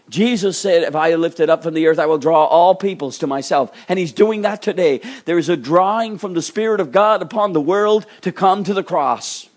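high, loud proclamation; ringing, emphatic sermon climax; forceful preaching. Real, not acted. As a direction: declare it to the whole congregation.

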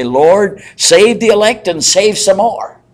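a touch of a preacher praying aloud; fervent prayer. Retold or imitated. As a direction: cry out a bold prayer. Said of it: "D L Moody used to say when he was praying".

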